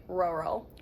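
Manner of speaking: relaxed "rural", no tightness in the R's; relaxed, falling mouth. Shown as a demonstration. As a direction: let "rural" fall out of a slack mouth. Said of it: "sounds like your mouth is just, falling".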